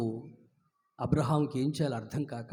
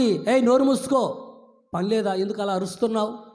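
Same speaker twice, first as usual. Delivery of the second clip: rebuking; 5 semitones higher, 4 dB louder than usual. A narrator's reading. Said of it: "వాడిని గద్దిస్తున్నారు".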